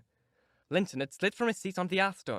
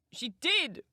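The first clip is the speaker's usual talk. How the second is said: high, sharp cry of denial; 10 semitones higher than usual. Acted. Young lady crying out against her cousin's claim. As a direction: fling the denial at him. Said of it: "cried my young lady".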